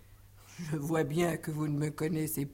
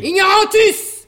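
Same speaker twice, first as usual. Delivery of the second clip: high mock Latin shout; 15 dB louder than usual. Mock-Latin insult as climax.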